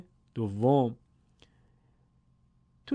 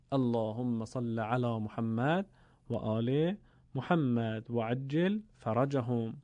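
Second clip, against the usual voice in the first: salawat prayer; 3 semitones lower, 5 dB quieter, slower than usual. Real, not acted. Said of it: "یک صلوات عنایت کنید".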